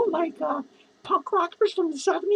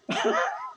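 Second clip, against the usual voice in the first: high cackle; 6 dB quieter than usual. Real, not acted. After the banana cream pie threat, high cackle. Drunk vlogger ends his rant.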